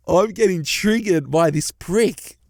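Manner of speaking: laughing through the words. Real, not acted. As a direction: chuckle while you say it. Laughing at the boyfriend's comment.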